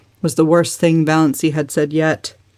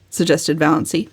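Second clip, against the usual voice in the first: usual speaking voice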